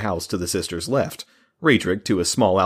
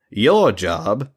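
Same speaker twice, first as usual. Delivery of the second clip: pointed mage giving orders, pitched up at first; pointed, commanding; 4 dB louder than usual. A narrator's reading. Mage assigns the men their job, pointed and commanding. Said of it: "he added in a pointed, commanding tone".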